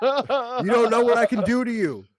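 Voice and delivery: high, loud taunting threat; shouted threat. Acted. Player threatens the revived man.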